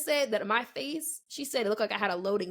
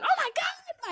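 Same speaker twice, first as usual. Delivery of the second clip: mock mom yell; slower than usual. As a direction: mimic a yelling mom, way up high. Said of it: "some mom in the back yelling".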